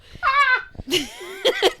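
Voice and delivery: shrieking burst of laughter; real laughing fit; loud high laugh. Real, not acted. Laughing at own Bigfoot fandom.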